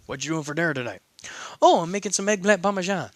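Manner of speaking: ordinary delivery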